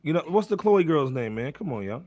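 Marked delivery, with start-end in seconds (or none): none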